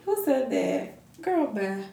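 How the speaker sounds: quiet and spent from laughing; worn out after a laughing fit; after laughing hard. Real, not acted. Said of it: "My stomach hurt".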